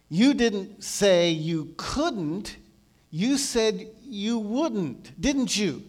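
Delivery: very slow, stressing "couldn't" against "wouldn't"; insistent contrast. Retold or imitated. Believer questioning the Lord's words, insistent contrast. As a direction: weigh each word, leaning on "couldn't" and "wouldn't". Questions Jesus, weighing each word.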